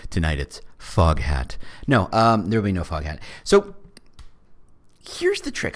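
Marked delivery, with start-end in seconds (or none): 0.1-1.6 mock radio host introducing tonight's band; AM radio personality voice. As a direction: roll it out like a late-night rock DJ. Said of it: "drift into the A M radio personality voice".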